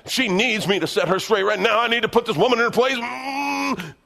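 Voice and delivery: high, loud, indignant self-justifying; the flesh talking itself into a fight; self-righteous flesh. Retold or imitated. Husband arguing with wife, indignant and loud. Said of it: "I can even justify it".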